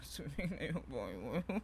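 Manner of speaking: slow, drawn-out self-mocking whine; stretched out, rising at the end like a mock cry; mock sing-song lament. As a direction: tease your own failure with a slow rising sigh. Repeats his failure in mock tone.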